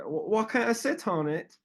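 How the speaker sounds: high-pitched mock Australian accent; put-on Australian girl voice. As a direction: imitate an Aussie asking, pitched well up. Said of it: "that was a good accent".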